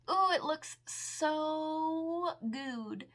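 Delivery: long, savoring coo of approval; really delighted, lingering on every word; drawn-out delight. Real, not acted. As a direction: gush slowly, stretching out the words. Delighted reaction to swatched makeup.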